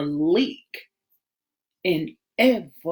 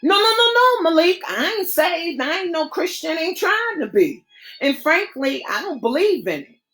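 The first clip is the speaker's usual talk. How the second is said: higher female voice, earnest and pleading; higher, earnest friend begging him not to talk that way; Rashida, pleading; 5 semitones higher than usual. Acted. Rashida's voice in story.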